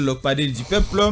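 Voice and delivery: usual speaking voice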